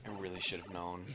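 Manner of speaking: soft, low, rueful; mock regret. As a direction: sigh it out ashamed. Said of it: "She's like".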